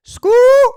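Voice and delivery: piercing high call to get his attention; a shrill little "scoo" across the street; sharp high whistle. Retold or imitated. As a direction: friend calls out from the squad car window. Friend signals from cop car.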